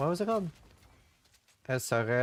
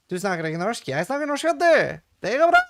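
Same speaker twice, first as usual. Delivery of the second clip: higher, louder, building to a cheer; excited Norwegian; 7 semitones higher, 7 dB louder than usual. Real, not acted. Showing off his Norwegian to chat, excited. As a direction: burst out happily in Norwegian.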